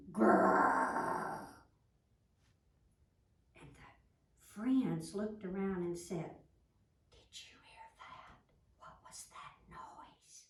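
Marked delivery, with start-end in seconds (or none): 0.1-1.5 breathy unvoiced growl; practice growl. Ladybug practicing her growl inside the log, rough growl. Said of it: "She was going". 7.1-10.5 hushed scared whisper. Acted. Frightened friends hearing a noise, whispering.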